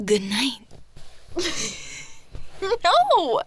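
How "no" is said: high, louder indignant protest; mock outrage. Girlfriend accusing her partner of laughing, mock outraged. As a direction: call them out in playful fury.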